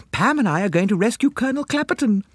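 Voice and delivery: put-on high, girlish, eager announcement; excited girl. A narrator's reading. Girl announcing a rescue plan, excited high voice. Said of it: "cried Kitty Mooney".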